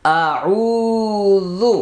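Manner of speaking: আউযু chanted very slowly with the ও vowel stretched one alif long; drawn-out recitation. Shown as a demonstration. Teacher modelling for students, slow stretched আউযু. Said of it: "এক আলিফ টেনে পড়তে হবে".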